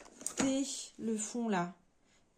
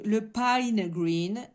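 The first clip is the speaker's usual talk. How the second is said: "Pine Green" slow and louder, "pine" as an English diphthong; English accent; 7 dB louder, much slower than usual. Shown as a demonstration. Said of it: "vous allez pouvoir écouter mon accent". (accent anglais appuyé, lent et plus fort sur « Pine Green »)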